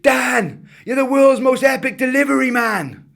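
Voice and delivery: put-on boy shouting through a van window. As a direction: wake the sleeping driver with a shout. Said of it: "banged the window and shouted".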